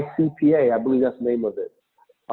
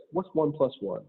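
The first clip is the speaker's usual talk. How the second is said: soft deadpan question; straight face; 7 dB quieter than usual. Shown as a demonstration. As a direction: ask it quietly, keeping the laugh out. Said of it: "do it with a straight face".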